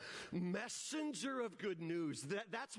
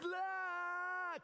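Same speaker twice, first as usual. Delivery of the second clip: long, high-pitched wordless yell; mock pep-rally scream, held out; imitated kids' scream; 10 semitones higher than usual. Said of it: "Kids just screaming".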